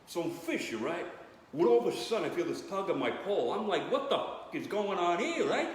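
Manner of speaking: lightly done Brooklyn Italian fisherman telling his story; Brooklyn Italian guy. Retold or imitated. Comedian imitating the Brooklyn Italian shark survivor, Brooklyn accent. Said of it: "I'm sure the guy's like".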